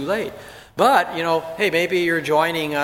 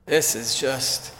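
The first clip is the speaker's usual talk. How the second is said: upset; 3 semitones lower, 4 dB quieter than usual. Retold or imitated. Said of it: "he was so upset".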